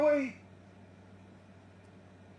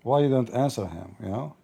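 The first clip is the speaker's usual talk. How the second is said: imitating Muslims; 11 semitones lower than usual.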